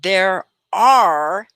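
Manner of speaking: slow drill, three stressed; careful teacher repetition; slow model sentence. Shown as a demonstration. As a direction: model it slowly again. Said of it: "can you say it?".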